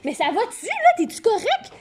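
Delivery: lightly acted high-pitched cry; rising, worked-up question without much volume; agitated driver. A narrator's reading. Driver checking on the child after swerving, agitated. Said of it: "le conducteur sort l'automobile énervé et crie".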